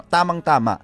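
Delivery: ordinary delivery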